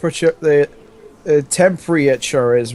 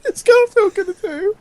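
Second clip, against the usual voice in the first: mock squeaky voice. Mocking Garfield's reaction.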